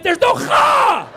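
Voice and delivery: very high, drawn-out mock-outraged shriek; exasperated shout. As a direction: scream the punchline in comic despair, stretching it out. Repeats the exasperated punchline.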